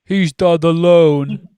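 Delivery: loud, slow, far-off shout from above. Acted. Friend shouting from above to save Harry. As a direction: call down to them at full volume. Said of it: "shouted a voice from above them".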